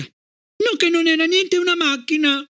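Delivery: put-on very high child's outburst; shrieking. A narrator's reading. Gloria contradicting her mother, shrieked protest. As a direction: protest with all her might. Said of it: "strillò Gloria".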